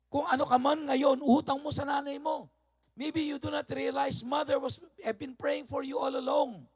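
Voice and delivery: high, loud preaching at full force; preacher pressing the point hard on the church; fired-up preaching. Acted. Preacher telling the congregation they owe their mothers, full-force high preaching.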